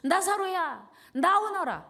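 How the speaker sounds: high, booming call; loud command. Acted. Jesus calling dead Lazarus out of the tomb, loud commanding shout. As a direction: cry out to summon him from afar. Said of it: "무덤을 향하여 큰 소리로".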